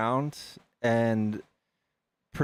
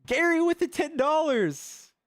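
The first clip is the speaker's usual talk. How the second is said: much higher, thrilled announcement; excited shout-out. Real, not acted. Streamer hyping a donation from Gary, excited. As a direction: cheer the donor on.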